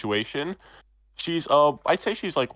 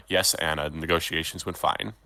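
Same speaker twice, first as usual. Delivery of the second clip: lower, resigned reply to the maid; sighing master; 7 semitones lower, 4 dB quieter than usual. Acted. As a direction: give in with a tired lower voice. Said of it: "[name] sighs like".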